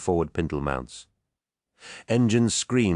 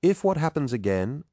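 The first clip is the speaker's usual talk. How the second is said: puzzled; 10 semitones higher than usual. A narrator's reading. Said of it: "looked at one another in puzzlement".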